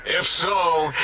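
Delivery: ordinary talk, nothing marked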